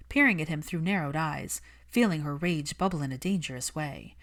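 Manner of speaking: ordinary delivery, nothing marked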